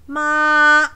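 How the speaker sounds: ma held high and long; long level ma. Shown as a demonstration. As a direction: sustain "ma" loudly on one high note. Demonstrating the sound of ma.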